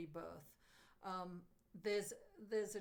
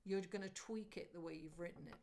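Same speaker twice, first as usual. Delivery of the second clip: ordinary delivery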